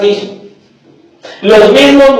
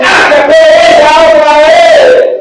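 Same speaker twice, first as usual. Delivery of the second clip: loud, slow, whiny groan; mock annoyed whine; 5 semitones higher, 3 dB louder, slower than usual. Pastor mocking members annoyed by correction, loud whiny groan. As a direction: drag it out like a fed-up member.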